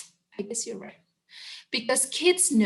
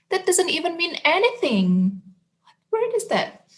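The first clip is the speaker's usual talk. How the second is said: higher, baffled protest; 7 semitones higher, faster than usual. Pretend. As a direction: sound puzzled and put out. Reader baffled by nonsense word.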